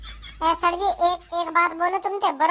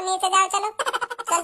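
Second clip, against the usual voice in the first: ordinary talk, nothing marked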